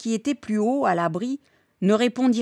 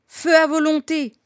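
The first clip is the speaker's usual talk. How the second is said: high, loud battle order; commanding shout; 7 semitones higher, 5 dB louder than usual. A narrator's reading. Chief repeating orders in the battle, commanding shout. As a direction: yell it to all the riflemen. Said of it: "L'ordre se répétait".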